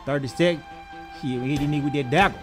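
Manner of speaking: ordinary talk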